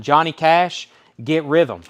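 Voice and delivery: punchy auction call; loud announcement. Real, not acted. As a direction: announce the lot to the room. Auctioneer announcing next record.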